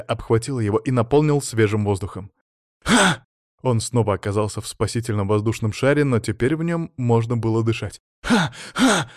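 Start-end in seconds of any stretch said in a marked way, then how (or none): 2.8-3.2 high, sudden gulp of breath; sharp gasp for air. Acted. Sanya gets air back underwater, gasping. 8.2-9.1 two high, sharp gulps of breath; gasps for air. Acted. Sanya can breathe in the bubble, gasping.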